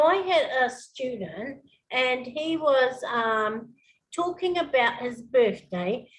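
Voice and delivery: usual speaking voice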